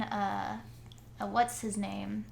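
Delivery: usual speaking voice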